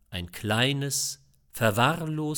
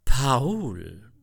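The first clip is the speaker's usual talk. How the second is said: composed mother; 6 dB louder than usual. A narrator's reading. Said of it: "sagte die schon wieder gefasste Mutter".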